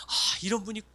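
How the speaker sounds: fervent prayer. Shown as a demonstration. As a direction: pray it with urgent longing. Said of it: "이렇게 집중적으로 기도하는 거예요".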